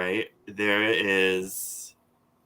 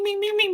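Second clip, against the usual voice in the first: phone pinging sound; faster than usual. Retold or imitated. Phone going off with notifications.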